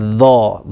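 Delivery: ذا said with a heavy, deep emphatic sound; teacher shows ذا with a heavy, emphatic voice; heavy thick ظا sound. Shown as a demonstration. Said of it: "ذا کو موٹی آواز سے پڑھنا ہے".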